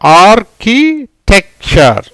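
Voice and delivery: "Architecture" slowly broken into syllables. Shown as a demonstration. Teacher answers what word results, "architecture" in slow separate syllables. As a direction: build "architecture" piece by piece, pausing between parts.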